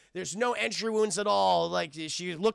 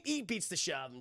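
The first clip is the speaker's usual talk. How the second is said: ordinary delivery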